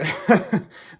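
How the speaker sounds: quick burst of laughter; short laugh. Real, not acted. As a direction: laugh it off in disbelief. Laughing at United's collapse.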